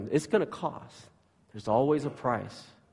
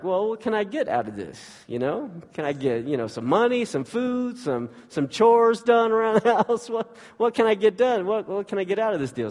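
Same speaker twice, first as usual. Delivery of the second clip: put-on higher, scheming child's voice, getting faster toward the end; bargaining kid voice; 8 semitones higher than usual. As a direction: play the little dealmaker sister. So Brooklyn was like.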